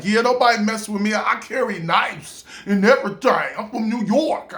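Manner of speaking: put-on high, fast, tough-girl boast; imitated quick, defiant New York comeback; New York attitude. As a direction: brag like a fearless New Yorker. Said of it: "she had this New York".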